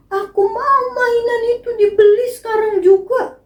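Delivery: high, sing-song mock crying kid; crying child. Child crying in the toy shop, high mock wail. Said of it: "menangis di toko".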